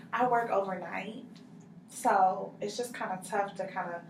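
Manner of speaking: usual speaking voice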